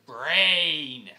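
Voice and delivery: long, loud drawn-out "Brain"; loud scolding holler; exasperated shout. Real, not acted. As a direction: bellow the word and stretch it out. Exasperated lecture to absent character.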